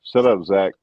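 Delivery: ordinary delivery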